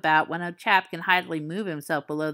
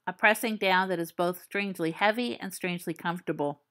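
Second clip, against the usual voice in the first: ordinary delivery, nothing marked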